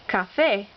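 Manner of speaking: café with FÉ stressed; stress on accented syllable. Shown as a demonstration. Teacher listing words with written accents, stress on accented syllable. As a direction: land on the final syllable of café. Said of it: "words that need written accents".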